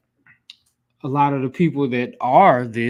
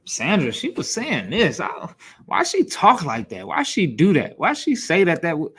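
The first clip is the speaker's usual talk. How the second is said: gossiping woman; 6 semitones higher, 3 dB louder than usual. Retold or imitated. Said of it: "it's like".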